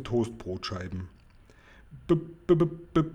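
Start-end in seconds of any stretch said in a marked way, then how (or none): 2.1-3.0 put-on high, stuttering little girl pleading; ängstliches Stottern. A narrator's reading. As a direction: stammer on the first sound, pleading in a higher voice. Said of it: "stotterte Sophiechen". (ängstlich stotternd, mit hoher Stimme)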